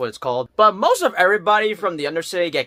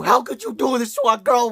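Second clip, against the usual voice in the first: mock betrayed scolding; 7 semitones higher than usual. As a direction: accuse her, less shrill this time. Scolding the character.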